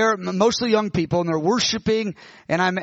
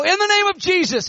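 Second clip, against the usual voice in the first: much higher ringing command; commanding shout; 11 semitones higher, 3 dB louder than usual. Retold or imitated. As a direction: cry it out over the running crowd. Commanding healing over the crowd.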